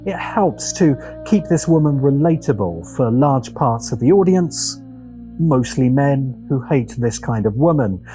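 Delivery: normal talking voice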